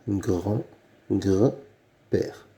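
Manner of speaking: grand, gr-, père split into parts with pauses; sounding out. Shown as a demonstration. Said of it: "Il y a bien le son gr dans grand-père".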